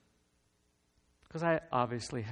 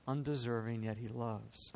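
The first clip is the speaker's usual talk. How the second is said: usual speaking voice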